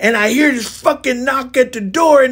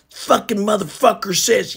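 ordinary talk, nothing marked

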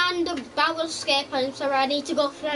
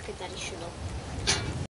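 softer unvoiced whisper; whispered aside; 11 semitones lower, 11 dB quieter than usual. Real, not acted. Gamer hushing a teammate, whispered. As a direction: whisper it off-mic.

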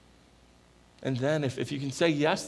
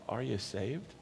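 soft earnest question; 4 semitones lower, 9 dB quieter, slower than usual. Real, not acted. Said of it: "soft probing question".

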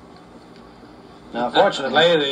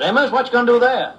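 eager question; 8 semitones higher, 4 dB louder than usual. Acted. Porch neighbour asks, eager.

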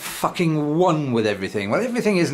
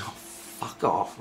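muttered swearing; 6 semitones lower, 9 dB quieter, much slower than usual. Retold or imitated. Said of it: "obviously not like I just did".

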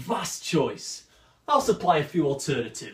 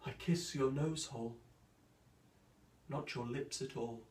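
much softer, near-silent lover's murmur; tender lover; 2 semitones lower, 15 dB quieter than usual. Acted. Pyramus to Thisbe through the wall, tender and very soft. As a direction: breathe it like a secret love vow. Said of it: "Or Pyramus, with Thisbe by the wall".

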